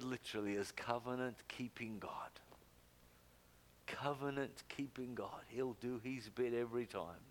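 whispered, reverent aside; hushed whisper. Real, not acted. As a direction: lean in and whisper it. Hushed explanation of the name.

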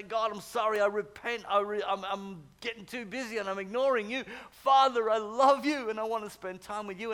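loud, pleading prayer of repentance; fervent prayer. Shown as a demonstration. Preacher modelling a repentant prayer for the congregation, fervent and loud. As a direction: cry out to God in earnest.

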